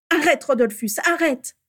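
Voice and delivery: high, urgent, loud plea; pleading. A narrator's reading. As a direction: beg him to stop. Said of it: "supplia Bellatrix". (supplication pressante)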